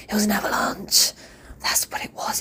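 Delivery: hushed, unvoiced realisation; dramatic whisper. Real, not acted. Mum telling her followers about the breaking point, dramatic whisper. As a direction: breathe it out like a revelation. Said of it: "dramatic realisation".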